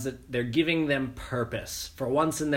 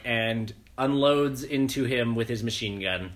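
ordinary talk

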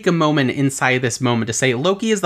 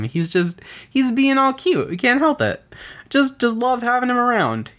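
lightly mocking, higher-pitched fond gushing; mock-adoring, pitched up; doting excuse; 8 semitones higher than usual. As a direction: imitate a smitten friend making excuses. Mimics Loki's doting, excusing friends.

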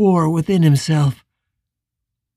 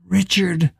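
ordinary delivery, nothing marked

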